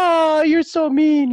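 high, loud, drawn-out wail; mock childish protest, slow and loud; imitating upset child. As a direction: stretch it out like a wounded kid. Voices upset child's complaint.

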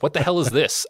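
mock bewildered arrival. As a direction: throw up your hands at the chaos. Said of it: "every time I show up, I'm like".

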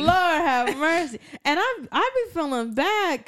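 laughing through a grandma sigh; laughing old lady. Pretend. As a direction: chuckle it out like a weary granny.

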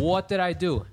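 high, loud retold protest; indignant protest. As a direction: protest your innocence loudly, pitch jumping up. Retold argument, protesting innocence.

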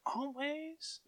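a high, hushed, put-on Finn voice, imitating him; mock gruff Finn. Retelling how Finn answered Rey, in a hushed, imitating voice. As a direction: squeeze out one sulky word as Finn would. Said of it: "That's the way he said it".

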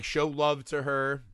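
ordinary talk, nothing marked

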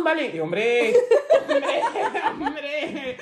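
normal talking voice

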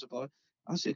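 usual speaking voice

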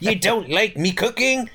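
put-on a comic Irish old man; leprechaun. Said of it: "You made him sound like Leprechaun there".

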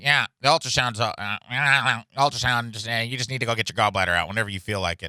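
buzzy doctor yammering between phrases; mumbling country doctor. Retold or imitated. Doctor phones patient about ultrasound, mumbling impression. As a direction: imitate a doctor mumbling through his sentences. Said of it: "He's like".

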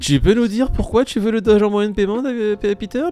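teasing. Real, not acted. Teasing host questioning co-host.

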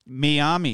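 "Miami" as mee-AH-mee; "Miami" pronounced mee-AH-mee; alternative pronunciation. Shown as a demonstration. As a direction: say "Miami" with ee first and ah in the middle. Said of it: "you just pronounce the I's differently".

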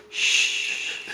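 telling a friend how to shush the man, whispered shush. Retold or imitated. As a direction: hush someone with a breathy shh.

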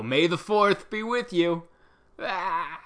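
"May the fourth be with you." hammed up, high, loud and slow; cheesy fan greeting. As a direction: drag out "fourth" like an eager fan. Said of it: "people that would go".

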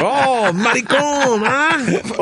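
high, drawn-out mock call; teasing onlookers. Workers teasing the podcaster, high drawn-out jeer. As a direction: stretch it out high, mocking. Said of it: "They're like".

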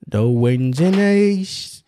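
put-on slow, drawn-out Mary Poppins Cockney accent. Host coaxing co-host with Mary Poppins line, slow Cockney accent. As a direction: do a theatrical film-musical Cockney.

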